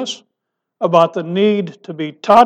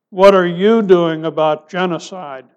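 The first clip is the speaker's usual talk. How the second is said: ordinary talk, nothing marked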